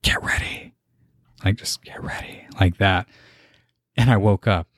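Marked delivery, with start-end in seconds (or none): 0.0-0.7 soft breathy whisper; hushed whisper. Retold or imitated. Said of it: "I just hear an audible whisper". 1.8-2.5 barely audible whisper; faint hushed whisper; soft whisper. Retold or imitated. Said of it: "I just hear an audible whisper, real soft".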